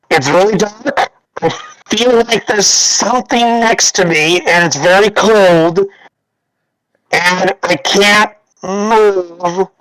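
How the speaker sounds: frightened, halting and slow, calling for the captain; a lost man pleading from inside a box; slow fearful calls in the dark; scared trapped voice. Acted.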